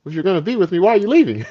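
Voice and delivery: very high, loud comic indignation; mock bewildered protest. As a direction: squawk in disbelief at being abandoned. Retold bewildered protest at being left.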